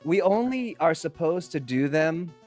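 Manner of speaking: ordinary delivery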